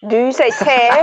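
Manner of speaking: put-on British question; mock British accent. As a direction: imitate a British speaker saying "tea" as "teh". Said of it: "you don't say none of that".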